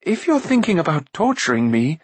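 high-pitched plucky prince; stout defiance. A narrator's reading. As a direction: brave it out in a high voice. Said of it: "he faced Akron stoutly".

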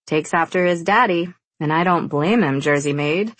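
ordinary delivery, nothing marked

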